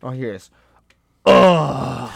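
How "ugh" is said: relieved groan. Retold or imitated. Friend groaning relief while peeing.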